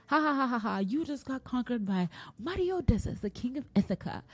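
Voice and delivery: high-pitched mock boast with theatrical laughter; taunting Odysseus. Odysseus turning round on the escaped-from Cyclops, mock taunting. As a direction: gloat like a hammy victorious hero. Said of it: "best your opponent is taunt them".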